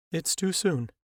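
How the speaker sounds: a grieving mother pleads with the doctors; pleading mother. A narrator's reading. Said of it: "the woman pleaded with the doctors".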